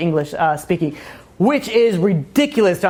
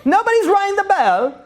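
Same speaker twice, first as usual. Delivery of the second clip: high, piping mock English voice; cartoon English lookout calling; hammed-up English caller; 14 semitones higher than usual. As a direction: sing out high like a caricature Englishman. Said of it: "and they'll be like".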